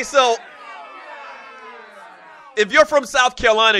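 ordinary talk, nothing marked

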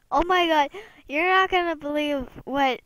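ordinary talk

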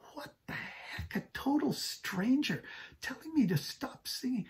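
whispered disbelief, rising high; shocked inner thought. Retold or imitated. Pastor's stunned inner reaction to the stranger, whispered. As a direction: gasp it in hushed disbelief. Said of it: "I thought".

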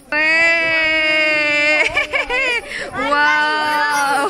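loud, high delighted shouts; excited cheering. Real, not acted. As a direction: cheer with joy. Cheering child on.

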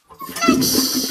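high, sung-out flush noise; mock flush sound. Imitating the toilet flush.

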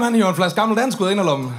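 normal talking voice

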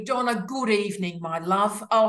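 ordinary delivery

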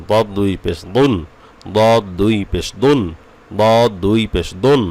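slow repeats, "দুন" with a thick d; heavy dad drill. Shown as a demonstration. Teacher drilling pupils ten times over, slow heavy "দুন". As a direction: repeat slowly, each "দুন" heavy and rising. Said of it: "দত দশ বার পড়েন".

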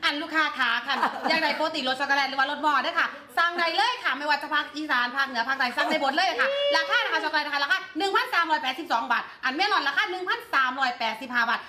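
lilting Isan sales pitch; Isan-accented Thai live-selling patter; Isan dialect. Shown as a demonstration. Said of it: "ว่าวอีสาน".

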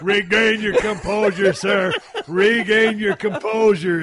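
drawn-out stern instruction, hammed up; Gordon Sullivan. Quoting a mentor to the shaky hunter, stern mock order. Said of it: "as Gordon Sullivan would say".

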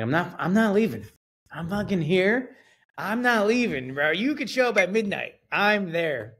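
stubborn insistence. Retold or imitated. Insisting he won't leave driveway.

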